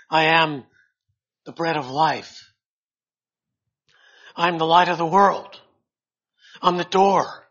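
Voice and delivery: loud, high, ringing declarations; proclaiming scripture. Acted. Preacher booming Jesus's I am sayings at the congregation. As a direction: proclaim each I am to the hall. Recites the seven I ams.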